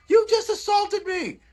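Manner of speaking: very high, slow, sarcastic falsetto; sarcastic high-pitched jab at the flag-swinging lady; mock outrage. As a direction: stretch it out in a mocking squeal. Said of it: "I'm like".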